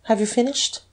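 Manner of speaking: high-pitched, cool question; coolly polite; cold. A narrator's reading. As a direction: ask it with cold composure. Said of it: "said coldly".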